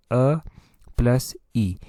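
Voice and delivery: अ and ई sounded one by one, apart; vowel parts spelled out. Shown as a demonstration. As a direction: pull ए apart into अ, then ई. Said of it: "ए को जो है वो दो अक्षरों से मिलकर बनाया गया". (अ और ई अलग-अलग बोलकर)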